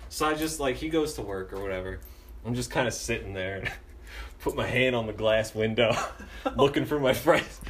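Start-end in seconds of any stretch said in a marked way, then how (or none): none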